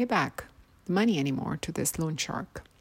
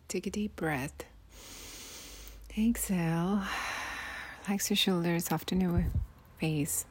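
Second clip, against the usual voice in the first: guided relaxation; 6 dB quieter than usual. Acted. Guides breathing before story.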